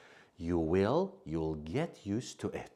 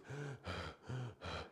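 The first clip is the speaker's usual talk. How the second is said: breathless sigh; 5 semitones higher, 12 dB quieter than usual. Shown as a demonstration. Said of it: "I'm out of breath means I can't breathe".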